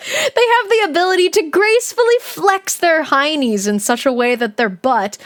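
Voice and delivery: ordinary delivery, nothing marked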